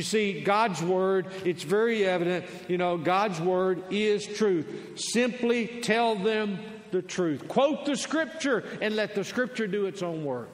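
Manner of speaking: insistent preacher, stretching key words; emphatic preaching. Real, not acted. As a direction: hammer the point into the congregation. Preaching at full force.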